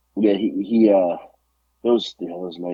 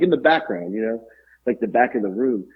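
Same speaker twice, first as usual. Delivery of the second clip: ordinary talk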